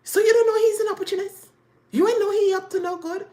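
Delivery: high, loud and fast scorn; scornful. Retold or imitated. The other woman mocks the wife, high, loud and fast. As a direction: rattle it off at her, mocking. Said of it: "And she was like".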